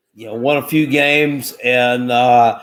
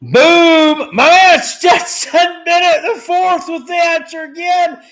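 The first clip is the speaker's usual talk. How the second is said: big announcer shout, much higher and louder; hype shout; 13 semitones higher, 6 dB louder than usual. Real, not acted. Hype announcing correct trivia answer.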